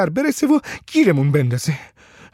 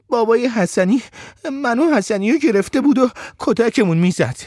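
put-on higher child's voice after sobbing; swallowing sobs; 4 semitones higher than usual. A narrator's reading. Boy explaining the beating to the men, holding back sobs. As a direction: start with sniffles, then explain in a raised pitch. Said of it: "حق حق گریه هامو خوردم و گفتم".

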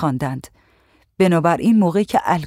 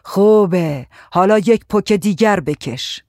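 louder, slow, drawn-out approval and order; domineering tyrant; 4 dB louder, slower than usual. A narrator's reading. Tyrant pushing a coughing girl to smoke, domineering. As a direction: draw it out, urging with authority. Tyrant urges another puff.

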